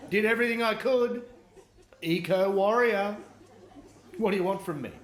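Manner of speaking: smug eco warrior. Retold or imitated. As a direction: plead your innocence proudly on the deathbed. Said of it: "and say".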